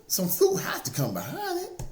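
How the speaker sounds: pitched high and loud, preaching hard; rising fervour. Real, not acted. Preacher drives his point home to listeners, high fervent call. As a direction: lift it up like a sermon peak.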